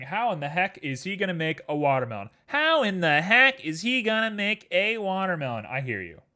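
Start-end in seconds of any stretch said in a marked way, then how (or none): 2.5-5.7 high, loud mock-incredulous viewer; hammed-up imitation of a doubting audience; viewer's voice. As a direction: put on an exaggerated, higher voice for a baffled onlooker. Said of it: "I said it in your voice".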